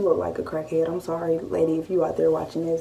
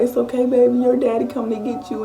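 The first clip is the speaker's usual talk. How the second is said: raised, louder voice of a crying mom; mock-weepy comforting, pitched up; imitated tearful reassurance; 6 semitones higher, 3 dB louder than usual. Mom consoling her daughter on the phone, imitated tearful reassurance. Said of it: "we just on the phone crying".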